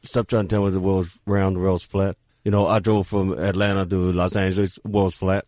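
deep, low basketball-star impression; Shaquille O'Neal. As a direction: insist it in a put-on deep voice. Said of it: "gonna get a deep, deep kinda".

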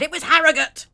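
high, loud triumphant shout; excited shout. Retold or imitated. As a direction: blurt it out like a quiz answer. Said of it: "just said".

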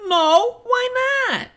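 very high falsetto; high-pitched comic character; Marie's squeaky voice. Acted. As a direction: play it in a put-on squeak. Marie questions Dora in script.

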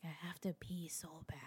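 breathy whispered plea, very soft; urgent whisper. Retold or imitated. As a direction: whisper it like you barely woke up. Woke up desperate, whispering urgently.

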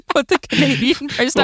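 high-pitched, giggly delivery; laughing. Real, not acted. Guest repeats her pricing joke to co-host, laughing. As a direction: say it through laughter.

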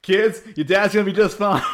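put-on loud, high call to the kids; hammed-up reassuring shout; mock cheery announcement. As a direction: call out over-brightly, barely holding back laughter. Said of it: "It's like The Simpsons".

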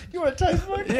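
high mocking quote. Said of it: "He's like".

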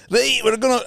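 high joking Scottish burst; mock Scottish. Joking that joggers talk Scottish, high mock accent. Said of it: "They're all doing Scottish accents".

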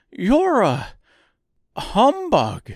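high, loud reproach; grieved. A narrator's reading. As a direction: accuse him, wounded. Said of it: "in a grieved tone".

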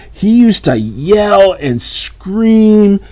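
slow, loud emphasis on yell and scream; emphatic drawn-out words. Real, not acted. Stresses how loud the man was.

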